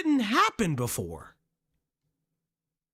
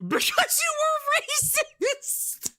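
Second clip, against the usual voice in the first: high shout; slower than usual. Real, not acted. Yelling back at Kendra on screen.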